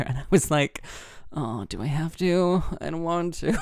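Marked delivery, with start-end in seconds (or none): 1.3-3.5 mock-sulky protest; reluctant whine. Acts out reluctant whining reply.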